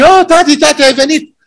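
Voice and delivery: put-on high, bright little child greeting daddy; excited child. A preacher voicing a child happy to see dad. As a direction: squeal it like a five-year-old.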